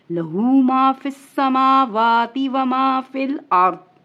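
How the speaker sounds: slow drawn-out chant; Quran recitation. Acted. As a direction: stretch the words out in tilawat. Recites next verse.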